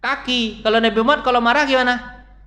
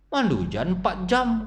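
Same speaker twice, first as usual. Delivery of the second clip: ordinary delivery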